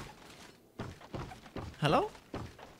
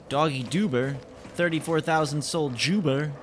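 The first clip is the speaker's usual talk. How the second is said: normal talking voice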